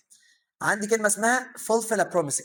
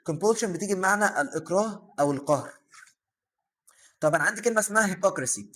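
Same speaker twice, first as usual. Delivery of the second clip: usual speaking voice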